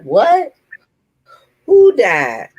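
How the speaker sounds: high, loud startled what, caught off guard; shocked disbelief. Retold or imitated. Caller telling the host she learned she died, shocked disbelief.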